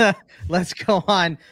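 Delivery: real laughter while talking; laughing. Real, not acted. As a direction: chuckle through moving things along. Host laughing while moving on.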